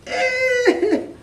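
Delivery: very high acted wail; mock weeping. A narrator's reading. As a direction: squeal out a pretend sob. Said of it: "she began to weep".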